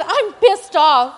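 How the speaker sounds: slow, loud, drawn-out I'm pissed off; slow emphatic. Retold or imitated. Telling the parent again that she is pissed off, slow and loud. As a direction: lean on every word. Repeating her reply with a laugh.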